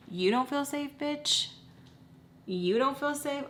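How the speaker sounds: rising, scornful challenge; mock disbelief. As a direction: fire it back in scornful disbelief. Scornful retort to his victimhood.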